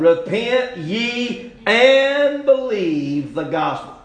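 loud, high, drawn-out group recitation; preaching scripture reading. Real, not acted. Preacher leading everyone in reading a verse, loud proclamation. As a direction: count them in and proclaim the verse.

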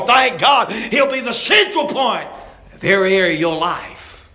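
loud, high, rising preacher cadence; full-force preaching. Acted. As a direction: preach it out to the congregation, building.

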